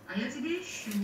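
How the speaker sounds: high, ringing call. A narrator's reading. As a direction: call out brightly over the crowd. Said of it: "Голос её раздался звонко". (звонкий голос)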